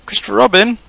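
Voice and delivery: high, loud call. A narrator's reading. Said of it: "he called down to you".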